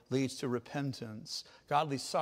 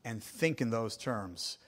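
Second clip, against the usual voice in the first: usual speaking voice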